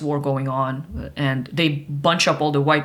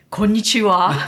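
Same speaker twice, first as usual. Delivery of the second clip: imitating the stranger; 4 semitones higher, 5 dB louder than usual. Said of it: "some random Russian dude comes up to me and goes".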